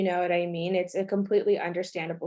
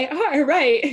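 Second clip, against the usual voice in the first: laughing agreement; 8 semitones higher, 9 dB louder than usual. Real, not acted. Friend echoes with a laugh.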